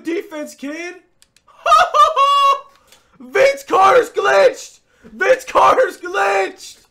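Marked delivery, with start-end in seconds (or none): 1.6-2.7 a high, very loud burst of laughter; real laughing shriek. Real, not acted. Reacting to a glitch. 3.2-6.9 loud, high, slow shouting; real shout of disbelief; disbelieving shout. Real, not acted. Shouting about glitch.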